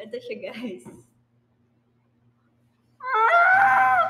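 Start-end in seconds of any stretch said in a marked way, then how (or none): 3.0-4.0 sudden high excited scream. Real, not acted. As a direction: let out a loud shriek of excitement.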